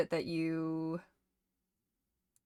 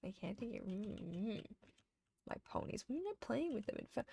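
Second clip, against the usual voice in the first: hushed grief; 2 semitones higher, 12 dB quieter than usual. Real, not acted. Overcome with grief over ponies.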